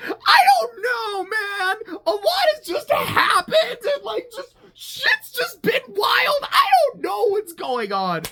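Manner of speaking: high, wailing shout with a fake sob; mock crying wail. Mocking Nyan's answer to Machu as a high crying shout.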